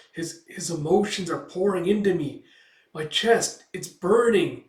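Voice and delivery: acted elf in anguish; overwhelmed distress. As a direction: cry out as feelings flood in. Elf overwhelmed, bent over.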